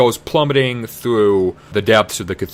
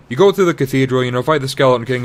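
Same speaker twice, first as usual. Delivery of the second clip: ordinary delivery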